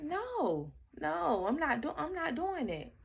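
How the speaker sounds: retold refusal, pitched high; protesting in a high voice; high, insistent refusal. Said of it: "I'm like".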